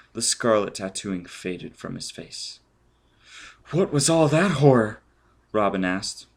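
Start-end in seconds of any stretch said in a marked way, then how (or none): 3.7-5.0 put-on higher, louder young woman's voice; a woman's raised, appalled question; Robin's shocked question. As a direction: ask it recoiling in horror.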